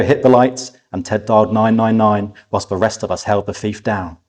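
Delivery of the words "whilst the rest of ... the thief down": usual speaking voice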